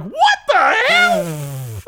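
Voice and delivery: high, very slow cracking shriek; hammed-up shocked cry of disbelief. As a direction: stretch it out in a shocked wail. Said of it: "and I'm like".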